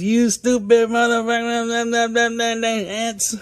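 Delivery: high, slow, hammed-up grumpy old man; mock cussing grandpa. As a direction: spit it out like a cranky cartoon grandpa.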